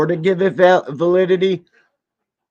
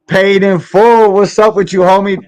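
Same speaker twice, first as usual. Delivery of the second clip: hyped greeting; 9 semitones higher, 8 dB louder than usual. Real, not acted. Hyped shout-out to chat.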